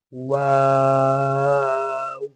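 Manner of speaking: "वाव" held long with rounded lips; stretched waw. Shown as a demonstration. Teacher reading the letter stretched as announced. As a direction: draw "वाव" out properly. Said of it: "proper खींच कर पढ़ते हैं".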